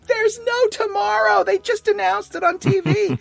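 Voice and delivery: piercingly high comic panic yell; hammed-up falsetto alarm, like a frantic cartoon kid; Sally. Girl at Snoopy's doghouse spreading the news, mock-hysterical yelling. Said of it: "she freaks out and starts yelling".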